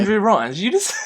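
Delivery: high, loud, breaking into laughter; amused disbelief. Real, not acted. Co-host pouncing on a wrong name, incredulous and laughing. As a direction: query the slip as a laugh bursts out.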